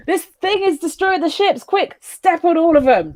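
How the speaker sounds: high-pitched, hurried alarm; rally them in a pretend panic; urgent mock command. Pilot hopping across ships under attack, urgent.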